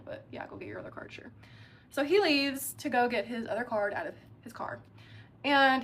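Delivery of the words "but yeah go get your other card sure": hushed, hurried near-whisper; muttered aside. Retold or imitated. Woman humouring her date's card excuse, muttered aside. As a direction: humour them under your breath, quickly.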